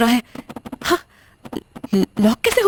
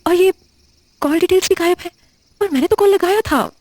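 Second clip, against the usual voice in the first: higher, faster fear as evidence vanishes; panicked; 7 semitones higher than usual. Acted. Shruti finds her calls and messages gone, panicking.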